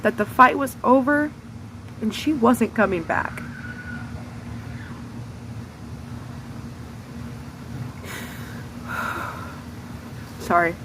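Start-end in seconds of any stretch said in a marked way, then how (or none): 3.5-10.3 a long unvoiced breath out before going on; a drawn-out real sigh; long shaky sigh. Real, not acted. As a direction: sigh out heavily as the memory lands. Long pause before apologizing.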